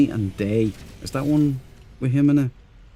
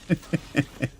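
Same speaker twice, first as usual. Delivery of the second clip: soft laugh; 6 semitones higher, 6 dB quieter than usual. Real, not acted. Laughing after movie quote.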